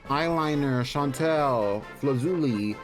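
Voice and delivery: normal talking voice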